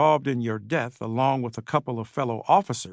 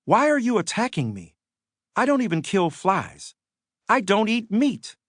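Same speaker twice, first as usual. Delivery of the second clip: higher, gently voiced plea, a hint of Elijah; pleading; 6 semitones higher than usual. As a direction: lightly voice a frightened young man begging. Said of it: "Then you pleaded".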